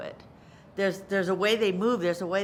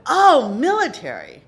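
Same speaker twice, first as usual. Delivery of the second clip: loud burst of military realization; 4 semitones higher, 11 dB louder than usual. Retold or imitated. Actor grasping the director's note, military. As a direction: punch it out big and high. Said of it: "She's very military".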